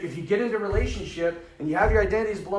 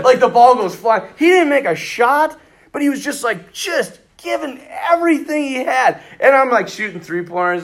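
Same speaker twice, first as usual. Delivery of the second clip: laughing; 8 semitones higher, 7 dB louder than usual. Real, not acted. Laughing retelling.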